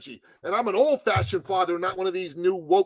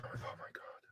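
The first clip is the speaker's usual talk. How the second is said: breathless whisper of disbelief; sinking whisper; whispered dismay; 25 dB quieter than usual. Real, not acted. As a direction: let it out with no voice. Energy just dropped.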